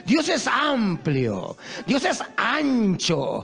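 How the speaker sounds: drawn out, every word weighed; slow emphatic preaching. Acted. Preacher tells the congregation God is vast in mercy, slow and emphatic. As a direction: lean on each phrase slowly, like a proclamation.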